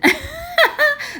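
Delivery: very high bursting laugh; a squeaky laugh at her own joke; high giggle. Real, not acted.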